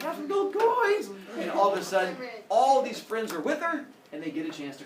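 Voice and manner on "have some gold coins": very high, slow, grand offer; generous showoff. Acted. Girl making it rain coins for new friends, high grand offer. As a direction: hand out coins like a big spender. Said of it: "She's like".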